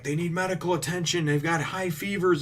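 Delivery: high-pitched imitation of a worried rescuer; retold urgent plea. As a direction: plead for help, pitched well up. Retold plea for sick children.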